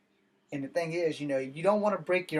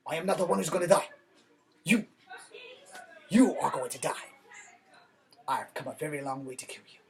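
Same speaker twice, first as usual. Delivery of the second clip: faster mock Chinese dub, fading to a whisper at the end; mock dubbed Chinese. Shown as a demonstration. Redoing the duel line as a Chinese dub, mock accent. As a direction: play the dubbed kung fu villain, ending in a whisper. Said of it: "than Chinese".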